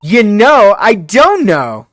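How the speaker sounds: loud high indignant yell; indignant shout. Acted. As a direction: shout it back, outraged. Indignant retort to excuse.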